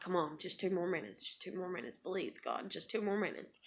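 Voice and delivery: hushed, begging under her breath. Retold or imitated. Pleading while child screams.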